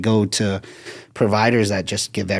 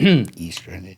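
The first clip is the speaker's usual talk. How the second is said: teasing copy of a non-American "Estrogen"; non-American accent on "Estrogen", long EE at the start; mimicked non-American accent; much slower than usual. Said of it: "I'm gonna keep my accent".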